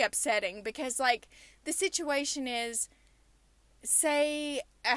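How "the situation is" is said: usual speaking voice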